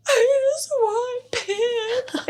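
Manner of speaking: put-on high, slow sobbing kid; crying toddler. Upset toddler quoted.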